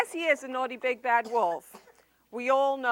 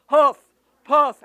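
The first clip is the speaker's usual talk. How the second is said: loud hammed-up huff and puff; host imitating the wolf's huffing, loud; 12 dB louder than usual. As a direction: blow it out like a big bad wolf. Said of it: "He'd go".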